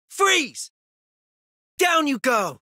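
high-pitched combat call; battle cry. Acted. Battle attack calls in game.

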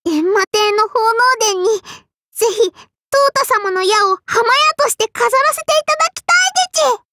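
high voice climbing to a rushed finish; eager, excited plea. Acted. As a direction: beg the hero eagerly, pitch rising all the way. Eager plea to admired hero. (興奮して高くうわずる懇願)

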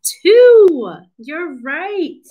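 high, delighted cheer for a right answer; excited praise. Pretend. Cheering children's correct answer.

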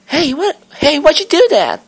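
squeaky offended character voice; indignant small Pokemon. Acted. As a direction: protest like a startled little critter. Reading game dialogue in voices.

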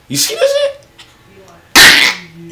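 sudden high startled yelp. Real, not acted. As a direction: yelp as the dog nips. Dog bit him.

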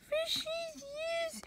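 very high, thin squeal of delight; excited squeal. Acted. Fascinated child at the aquarium, high excited squeal.

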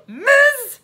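loud, high query; incredulous policeman. Acted. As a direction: boom it back in disbelief. Policeman queries the title.

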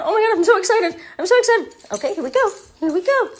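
high, loud, eager puppy; high-pitched, overexcited pet; excited dog voice. Pretend. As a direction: squeal like a dog about to go out.

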